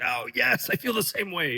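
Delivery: high-pitched, enthusiastic mock commenter; eager agreement. Imitating viewers connecting in the comment section, eager agreement. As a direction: play an excited fan agreeing.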